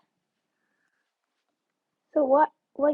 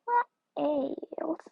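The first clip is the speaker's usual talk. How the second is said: usual speaking voice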